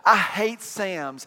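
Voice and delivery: I hate Sam's dragged out slowly with weight; emphatic hate. Real, not acted. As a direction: lean hard on each word. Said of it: "emphatic complaint".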